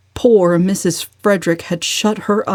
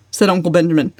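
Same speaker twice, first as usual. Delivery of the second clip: ordinary delivery, nothing marked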